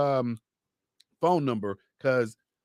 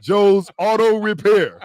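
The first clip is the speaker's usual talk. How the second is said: announcer plug; 5 semitones higher, 9 dB louder, slower than usual. Acted. Host hyping the sponsor's name.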